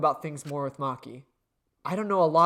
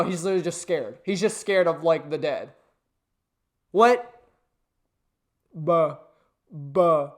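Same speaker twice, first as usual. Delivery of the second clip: normal talking voice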